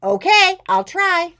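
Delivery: high, shouted agreement; loud child. A narrator's reading. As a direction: holler an eager promise. Loud Ruby promises to try.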